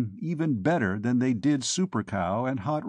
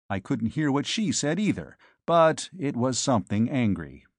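plain reading voice

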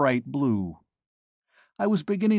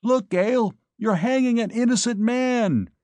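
much higher, louder yell; 11 semitones higher, 4 dB louder than usual. A narrator's reading. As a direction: yell over the din. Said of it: "I yelled back at her".

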